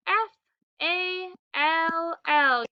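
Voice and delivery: letters "A L L" drawn out slowly, one by one; spelled out slowly. Shown as a demonstration. Teacher spelling fall slowly for children. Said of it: "We spell fall".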